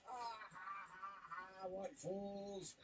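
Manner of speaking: slow, soft gloating cackle; mock villain laugh. Mets mocked as gloating villains.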